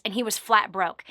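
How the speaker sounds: ordinary delivery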